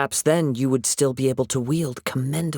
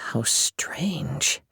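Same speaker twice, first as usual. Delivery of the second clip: hushed, breathy musing under his breath; whispered aside; 4 dB quieter than usual. Acted. Swordsman whispering to himself as the blade acts strangely. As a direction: murmur it to yourself, puzzled.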